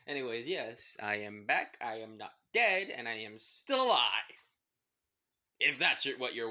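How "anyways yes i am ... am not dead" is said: ordinary delivery, nothing marked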